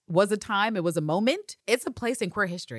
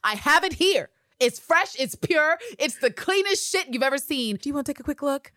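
higher, louder pushy dealer pitch; imitating Carolyn; 9 semitones higher, 5 dB louder than usual. Carolyn pushing the envelope on Eve, hammed-up dealer. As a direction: hawk it like a dealer with fresh stock. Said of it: "literally, she's like".

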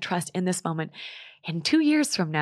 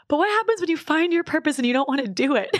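exasperated question; 9 semitones higher, 5 dB louder than usual. Retold or imitated. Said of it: "I'm like".

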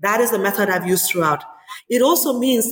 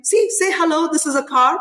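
raised pitch, eager prompting; excited showing-off; 8 semitones higher than usual. Retold or imitated. Mother showing her son off to her parents, excited prompting. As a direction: coax a small child to perform for listeners. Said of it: "calling my parents and saying".

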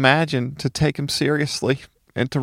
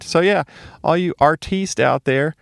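ordinary talk

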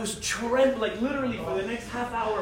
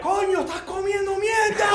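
high mock Cuban yell; shop guy yelling; 10 semitones higher than usual. Shop guy shouting at the worker, high mock yell. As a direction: bark it out like a Miami mechanic. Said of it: "he's like".

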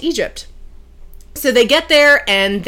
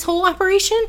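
incredulous; 12 semitones higher, much faster than usual. Retold or imitated. Man scoffs at her request.

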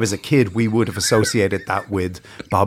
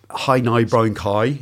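posh vowels; 2 semitones lower, slower than usual. Shown as a demonstration. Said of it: "sort of miscegenation of".

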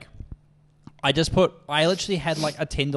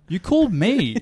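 higher and louder, hammering the point; you rang me, mate, come on; exasperated; 6 semitones higher, 5 dB louder, slower than usual. Retold or imitated. To a confused caller, fed up and insistent.

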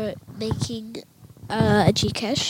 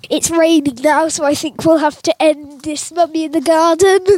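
high and loud, calling it out; 6 semitones higher, 11 dB louder than usual. Real, not acted. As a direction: call out the sign-off, high up. Shouting the sign-off in rain.

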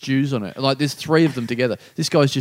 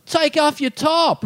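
high, drawn-out heckle; imitated drunken yell, pitched way up; mock heckler yell; 11 semitones higher, slower than usual. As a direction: holler it from the back of the room. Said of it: "this guy's yelling".

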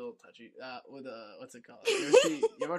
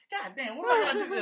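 high, loud, fast outburst with laughter; laughing, hurried wail of frustration; mock exasperation; 10 dB louder, faster than usual. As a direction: exclaim in comic despair, pitched way up. Sketch characters despair at being passed over.

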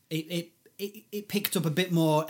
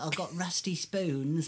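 slow, fake Cockney as an American would do it; mock American Cockney; 3 semitones higher, slower than usual. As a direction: drag it out in a put-on Cockney like Americans do. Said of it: "They always do it like that".

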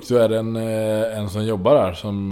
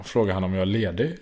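ordinary talk

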